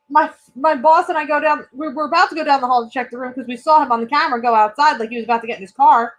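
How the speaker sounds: ordinary delivery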